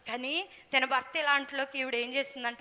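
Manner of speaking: ordinary talk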